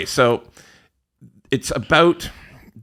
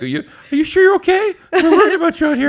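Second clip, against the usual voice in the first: very high, loud mock worry; genuinely concerned commenter; 7 dB louder, much faster than usual. Commenter checking that Ron is okay, high-pitched mock concern. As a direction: imitate a fretting viewer in a fast, high voice. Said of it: "They're genuinely concerned about you".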